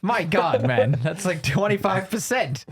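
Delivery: high, drawn-out mock indignation; hammed-up incredulous lord, stretching the words; outraged lord. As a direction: play an aghast feudal master refusing a raise. Outraged lord answers serf's petition.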